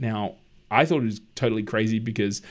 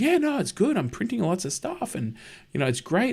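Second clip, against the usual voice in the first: lightly voiced carefree friend, pitched up high; breezy and unconcerned, higher than usual; cheerful friend; 10 semitones higher than usual. Friend brushing off the printer question, high and carefree.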